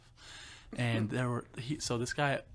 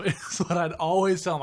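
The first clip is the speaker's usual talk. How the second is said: ordinary delivery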